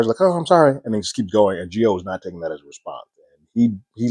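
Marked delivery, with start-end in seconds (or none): 0.2-0.8 tossed-off higher apology, barely meant; a careless kid brushing off a spilled drink, sorry said in passing; throwaway sorry. Retold or imitated. Kid brushing off Deb after spilling drink, careless throwaway sorry. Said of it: "a sort of a throwaway sorry".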